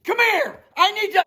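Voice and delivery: crazy-guy shouting, very high pitched. Acted. Builder pretending to rage at cement driver, yelling. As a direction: yell at the crew to scare onlookers. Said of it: "as soon as I raise my voice like that".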